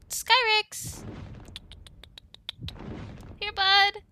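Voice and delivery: loud, high call to a dragon. Acted. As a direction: holler for your animal to come. Calling the dragon over.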